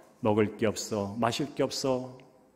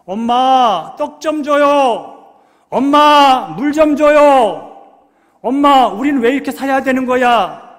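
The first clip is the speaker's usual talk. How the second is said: put-on crying child begging mother; 14 semitones higher, 14 dB louder than usual. Ishmael wailing to his mother for bread and water, high loud child's plea. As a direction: plead like a starving boy tearfully calling his mother. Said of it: "그런 상황이 전개됐겠죠".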